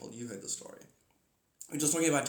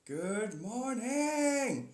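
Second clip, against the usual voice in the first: high, stretched good morning; slow rising sing-song hello; drawn-out greeting; 8 semitones higher, much slower than usual. Real, not acted. Calling out a greeting.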